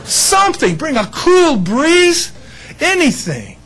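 high, slow plea to God; mock pleading. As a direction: beg for a breeze, hammed-up. Pleading for relief in heat.

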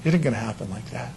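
Jesus quietly tells boastful Peter it won't happen, low and calm; calm firm Jesus. Acted. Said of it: "And Jesus said".

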